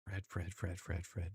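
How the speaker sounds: soft disappointed tut-tut; tutting softly. Real, not acted. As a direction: chide him under your breath, softly. Scolding Fred softly.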